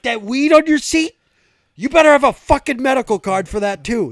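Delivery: high, loud imitated tirade; trooper losing it. Trooper spots weed on the driver's seat, yelling. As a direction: yell the accusation as an enraged cop. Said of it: "Fucking loses it".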